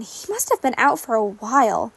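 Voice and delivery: ordinary delivery, nothing marked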